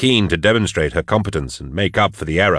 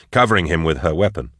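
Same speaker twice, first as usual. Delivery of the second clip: ordinary delivery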